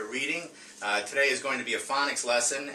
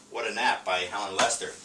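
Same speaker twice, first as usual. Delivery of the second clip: normal talking voice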